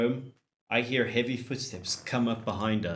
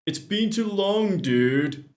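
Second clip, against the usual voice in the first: gym bro; 9 semitones higher than usual. Acted. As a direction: greet like a jock buddy. Craig voiced as bro.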